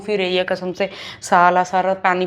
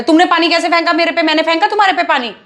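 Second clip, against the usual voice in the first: higher, louder indignant question; outraged; 7 semitones higher, 4 dB louder than usual. Acted. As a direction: demand an answer, incensed. Outraged about water. (पानी पर भड़ककर ऊँची, तेज़ आवाज़ में सवाल)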